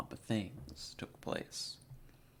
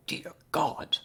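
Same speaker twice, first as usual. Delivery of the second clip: shocked exclamation; 6 semitones higher, 7 dB louder than usual. Acted. Doctor shocked at news.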